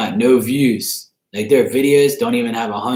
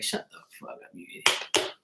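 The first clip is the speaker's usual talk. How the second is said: hushed whisper of an insult; whispered retort; 12 dB quieter than usual. Retold or imitated. Narrator retelling his reply to the streamer, whispered. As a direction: breathe the insult under your breath. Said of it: "I was like".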